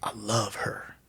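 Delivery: soft slow whisper; hushed mock-tender whisper; whispered love line. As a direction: breathe it like a confession. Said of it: "He's like".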